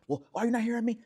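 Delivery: high, loud mock exasperation; worked-up protest. Man waving hands at his woman, worked up. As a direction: imitate an agitated demand to be heard. Acted worked-up argument quote.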